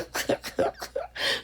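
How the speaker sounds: soft airy chuckle; a quiet laugh under the breath; breathy laugh. Real, not acted. Crafter laughing about not being down, airy chuckle.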